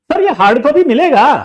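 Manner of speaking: high, loud nagging question; whiny student. Retold or imitated. Student pestering teacher, high loud whine. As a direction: needle the teacher.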